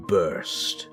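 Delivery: ordinary delivery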